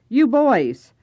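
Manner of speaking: put-on loud call out to the boys; sudden call. Old man calling to boys on his steps, loud sudden call. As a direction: stop them in their tracks with a shout.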